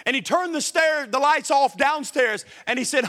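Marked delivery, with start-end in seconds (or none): none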